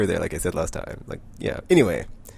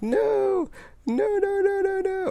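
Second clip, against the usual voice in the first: very high, drawn-out squeal; hammed-up cry far above own voice; high mock wail; 3 dB louder, much slower than usual. Wasp learning about Katie, high mock wail. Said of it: "if I do it in my voice".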